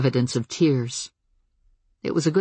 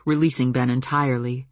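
plain reading voice

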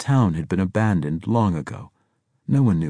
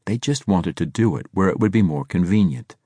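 usual speaking voice

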